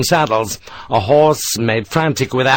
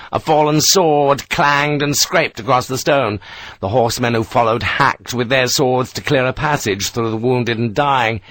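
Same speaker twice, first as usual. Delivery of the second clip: usual speaking voice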